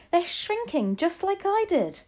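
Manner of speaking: put-on very high, astonished boy's voice; excited child. A narrator's reading. Boy tells grandmother the witches are shrinking, excited. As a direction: pipe it up high, amazed. Boy repeats his amazed discovery.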